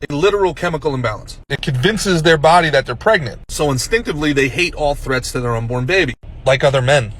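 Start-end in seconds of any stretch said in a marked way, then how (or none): none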